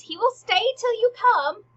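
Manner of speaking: high, loud singsong taunt; hammed-up high stage voice; acting Hamlet. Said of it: "And Hamlet goes".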